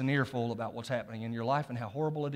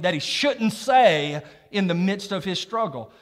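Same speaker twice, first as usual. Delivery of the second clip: loud, slow pulpit preaching; full-force preaching; 5 semitones higher, 7 dB louder, slower than usual. Acted. Preacher thunders scripture at the congregation, loud preaching.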